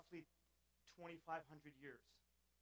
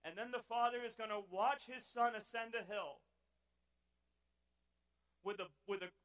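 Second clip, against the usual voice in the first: louder, building preacher's climax; preaching at full force; 5 semitones higher, 11 dB louder than usual. Acted. Preacher building to the cross for the congregation, loud and rising. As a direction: drive it home, swelling.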